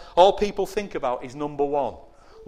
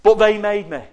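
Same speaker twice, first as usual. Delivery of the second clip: blame-shifting whine; 5 semitones higher, 8 dB louder than usual. Acted. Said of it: "They say".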